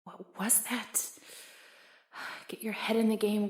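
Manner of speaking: whispered aside to herself; sigh, then whisper a pep talk to yourself; muttered self-scolding. Acted. Flustered coach telling herself to focus, whispered.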